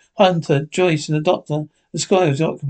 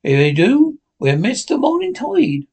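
urgent objection; 8 semitones higher than usual. A narrator's reading. Silver objects.

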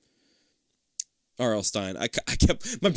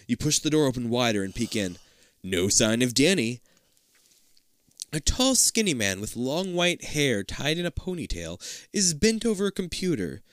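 ordinary delivery, nothing marked

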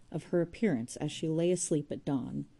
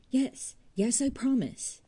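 higher, quick brush-off of a promise; impatient; 8 semitones higher, 4 dB louder, slower than usual. Acted. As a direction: get it over with, impatiently. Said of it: "said Jude impatiently".

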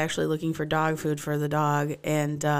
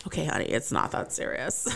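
put-on old lady; 6 semitones lower, 5 dB quieter than usual.

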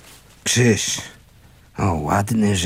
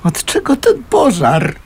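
distraught question; 10 semitones higher, 3 dB louder than usual. Acted.

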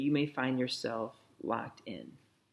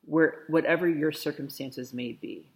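ordinary talk

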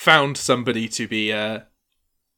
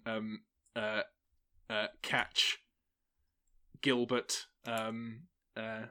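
ordinary delivery

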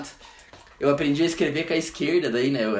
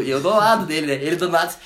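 ordinary talk, nothing marked

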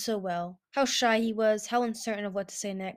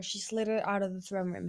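plain reading voice

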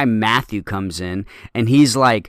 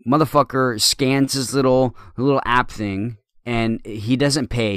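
normal talking voice

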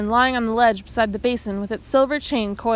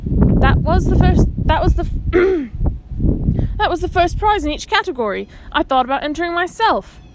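high, loud, voice wobbling with envy; gush, pitch wobbling up and down; excited and envious; 6 semitones higher, 4 dB louder than usual. A narrator's reading. Gillen pressing Omri, excited and envious. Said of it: "Excitement and envy were in Gillen's voice".

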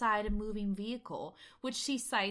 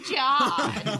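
laughing; 6 semitones higher, 11 dB louder than usual. Real, not acted. Cracking up at the joke.

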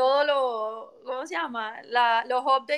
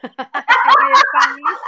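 laughing; 6 semitones higher, 14 dB louder, much slower than usual. Real, not acted. Laughing through the word.

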